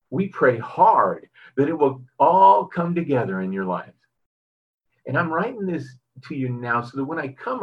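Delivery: lifted pulpit reading voice; scripture reading. Acted. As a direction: read it out like a preacher's text. Reads scripture passage aloud.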